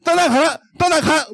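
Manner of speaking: high, forceful command; commanding shout. Shown as a demonstration. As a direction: banish it with a bold cry. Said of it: "이렇게 할 필요는 없어요".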